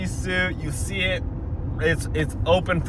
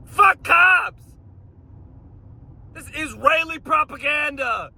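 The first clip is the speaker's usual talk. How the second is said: angry shout; 10 semitones higher than usual. Real, not acted. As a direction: rant at the screen. Ranting shout.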